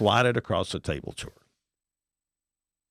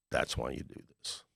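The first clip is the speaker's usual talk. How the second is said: whispered, softer realization; hushed; 7 semitones lower, 8 dB quieter than usual. Retold or imitated. Seller telling buyer's story, whispered in awe. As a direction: breathe it out, moved.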